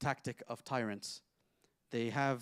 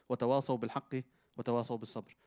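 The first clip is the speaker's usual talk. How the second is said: Quranic recitation; much faster than usual. Acted. Quoting a Quran verse.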